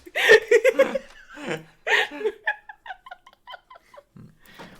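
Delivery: big, high, long cackle; loud high laugh. Real, not acted. Laughing at own character bit, loud cackle. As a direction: burst out laughing.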